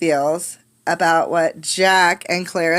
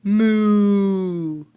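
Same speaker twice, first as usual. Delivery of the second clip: lowing cow; cow moo. Acted. Cow in the outdoor academy mooing.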